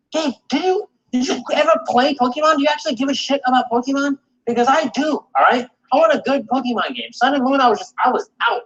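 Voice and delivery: high, heated exasperation; exasperated rant. Real, not acted. Venting at cohost Gabe about Pokemon, high exasperated rant. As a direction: plead with him, fed up.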